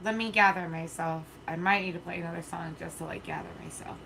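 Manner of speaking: soft, shaken attempt to gather oneself; quiet, trying to regain composure; emotional. Real, not acted. Streamer pausing after emotional viewer comments, emotional and subdued. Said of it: "super emotional".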